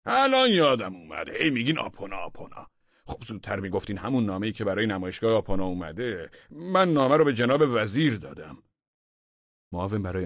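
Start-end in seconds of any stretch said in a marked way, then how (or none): 0.1-2.7 high, loud burst of remembering, then easing; sudden shout. A narrator's reading. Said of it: "بعد فریاد زد". 3.1-8.6 exclaiming. A narrator's reading. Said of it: "بعد فریاد زد".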